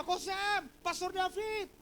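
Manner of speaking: high-pitched reenacted calls; calling out. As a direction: mimic two friends hailing each other. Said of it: "Langsung saya panggil dia".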